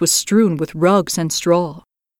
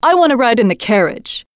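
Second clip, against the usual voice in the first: put-on spoiled robber girl; 5 semitones higher than usual. Robber girl insists on riding, higher demanding child. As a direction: whine it like a headstrong brat. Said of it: "the little robber girl said".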